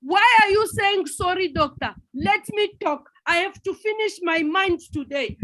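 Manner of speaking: agitated patient railing at her doctor; angry patient. Acted. Patient accuses the doctor in an agitated mock rant. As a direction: blame the doctor and threaten him.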